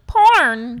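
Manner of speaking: loud, abrupt one-word shout; called-out answer. Retold or imitated. Twenty-year-old in the corner answering, calling it out. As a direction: blurt it out from the corner.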